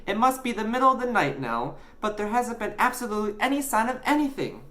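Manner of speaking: put-on high, quickening boyish voice; boy character, puzzled. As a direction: reason it out as a young boy.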